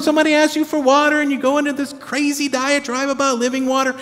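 high, fast, disbelieving complaint; can you believe this guy; incredulous rant. Retold or imitated. Preacher ranting in mock disbelief at Jesus's riddles.

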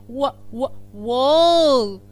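"و، و" then "وول" stretched very slowly and loudly; slow loud phonics model of whale; sounding out whale. Shown as a demonstration. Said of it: "بصوا انا نطقتها ازاي".

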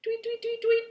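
high chirpy birdsong imitation; bird tweets. Host reading to kids, chirping like the red bird. Making the red bird's sound.

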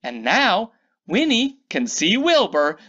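ordinary delivery

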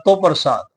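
"ਤੋ" said as "to", rounded vowel instead of the vav; aunkar reading. Shown as a demonstration. Teacher giving the scholar's alternative reading, rounded "o". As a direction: say "ਤੋ ਪ੍ਰਸਾਦ" with a rounded "o". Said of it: "ਅੱਧੇ ਵਵੇ ਨੂੰ ਉਚਾਰਿਆ ਜਾ ਸਕਦਾ ਹੈ".

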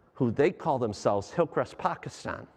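ordinary talk, nothing marked

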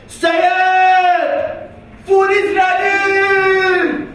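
high, drawn-out shout of a name; mom calling out. Retold or imitated. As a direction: call him to dinner across the house. Mom calling son to eat.

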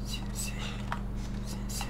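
breathy hush to keep hidden; very soft sneaking whisper. Real, not acted. Sneaking up on deer.

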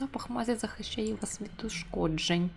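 quiet, half-whispered story narration; hushed narration. Acted. Reads game narration.

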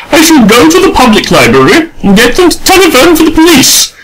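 a lady threatening to call the police; haughty announcement. A narrator's reading. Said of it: "announced Adela".

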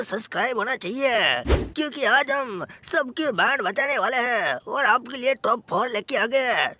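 ordinary talk

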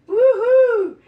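high, loud joyful woohoo; excited whoop. Real, not acted. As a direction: cheer it out like you nailed it. Cheering over taco toppings.

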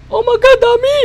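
high squeal of surprise; a sudden high Oh my God; surprised. Real, not acted. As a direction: shriek at how many there are. Sudden milk crowd surprise.

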